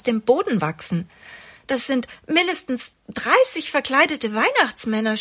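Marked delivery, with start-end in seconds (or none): 1.7-5.2 hilflos stöhnend. A narrator's reading. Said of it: "stöhnt sie hilflos".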